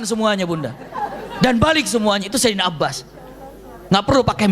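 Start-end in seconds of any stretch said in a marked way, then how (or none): none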